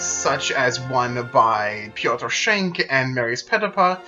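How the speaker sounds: normal talking voice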